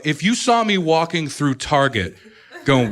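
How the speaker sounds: ordinary talk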